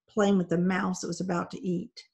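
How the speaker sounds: usual speaking voice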